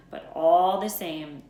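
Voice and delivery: ordinary delivery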